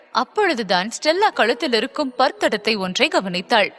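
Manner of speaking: usual speaking voice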